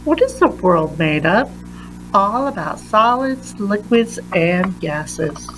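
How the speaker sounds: ordinary delivery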